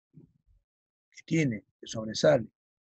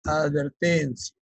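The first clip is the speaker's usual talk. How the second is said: dictating slowly; 3 dB louder, much slower than usual. Shown as a demonstration. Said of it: "le voy a poner la nota de advertencia".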